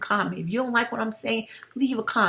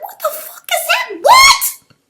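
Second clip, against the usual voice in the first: incredulous angry outburst, much higher and louder; getting angry; 13 dB louder, slower than usual. Real, not acted. Ranting to viewers about the freedman debate, angry shout. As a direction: blow up in disbelief, shouting the last What. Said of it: "y'all see me getting angry".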